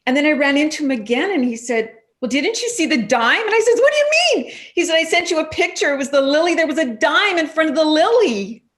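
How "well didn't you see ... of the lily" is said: excited retelling. Retold or imitated. Retelling excited exchange about dime.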